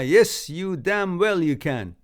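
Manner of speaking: hammed-up courtroom line, loud and high; 2 Few Good Men quote. Quoting A Few Good Men to a viewer, loud and hammed-up. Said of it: "Few Good Men?".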